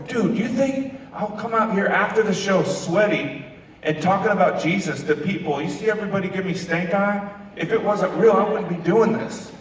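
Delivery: high-pitched, incredulous insistence; emphatic retort. Retold or imitated. Singer answering a fan who was amazed, emphatic and incredulous.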